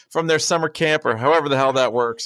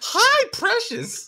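squeaky mock voice; much slower than usual. Teasing the cracked voice.

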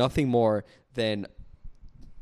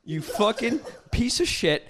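slow, higher, laughing through the insult; 8 semitones higher, slower than usual. Real, not acted. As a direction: spit it out while cracking up. Rant dissolving into laughter.

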